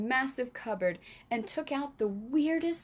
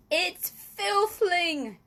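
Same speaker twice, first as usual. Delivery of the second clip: slow, high, louder sputter through food; sputtering mouth full; 8 semitones higher, 6 dB louder, much slower than usual. A narrator's reading. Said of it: "he sputtered, speaking with his mouth full".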